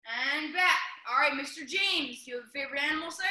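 ordinary delivery

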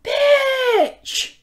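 very high, shrieked exclamation; excited shout. Real, not acted. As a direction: yell it out in joy. Reacting to the lavender vinyl.

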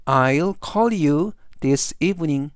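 very slow, careful English reading; slow model sentence. Shown as a demonstration. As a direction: spell out each word slowly for students. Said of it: "전체적 의미는". (아주 천천히 또박또박 읽는 예문)

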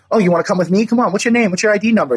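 imitated cop, pitched high; badgering cop. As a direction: fire off questions as a mimicked officer. Retold cop harassing him.

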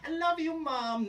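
hammed-up sweet child to mom; mock sappy declaration. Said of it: "We don't say".

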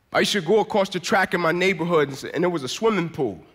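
ordinary delivery

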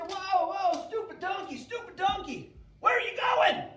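high, loud exasperated yelling at the donkey; angry Balaam. Acted. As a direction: shout at a stubborn donkey. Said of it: "Balaam starts saying".

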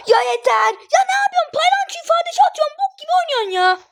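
high-pitched angry whine; exasperated rant. Real, not acted. Gamer to badly playing teammate, exasperated rant. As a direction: lay into them, voice climbing high.

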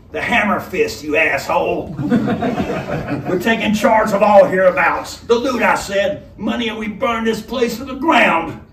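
laughing robber. Acted. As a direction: gloat and laugh at them.

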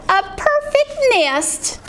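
high, slow goose voice; goose's drawn-out cry. Acted. Goose admires the nest, high drawn-out cry. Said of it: "she cried".

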